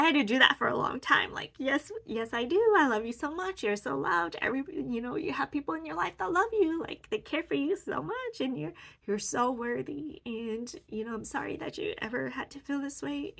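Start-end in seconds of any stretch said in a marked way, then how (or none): 1.6-13.3 put-on raised, loving baby-talk lilt; tender high reassurance. Comforting her younger self, tender high-pitched cooing. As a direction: pour love on her like on a small niece.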